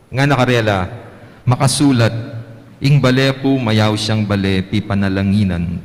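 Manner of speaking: measured, solemn reading from the lectern; Gospel reading. Acted. Priest reading the Gospel to the congregation, solemn.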